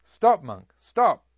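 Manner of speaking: loud, high commanding shout, rising on the last stop; shouting after him. A narrator's reading. The robber shouting at the monk to halt, loud and high. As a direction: yell it across the forest at him. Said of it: "called out to him".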